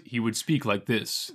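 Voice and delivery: slow, low-register Action Man voice; Action Man impression. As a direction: imitate an actor's low, deliberate hero voice. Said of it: "He had a very low register".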